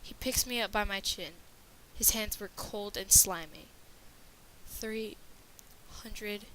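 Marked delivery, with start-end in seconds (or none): 4.7-6.4 weak. A narrator's reading. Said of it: "I weakly asked".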